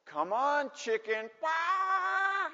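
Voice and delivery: high mocking chicken taunt, ending in a drawn-out squawk; teasing. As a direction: goad them like a schoolyard bully. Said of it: "they're teasing me".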